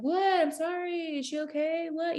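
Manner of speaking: high, soft mock-apologetic teen; jerky kid. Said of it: "kind of being a jerk".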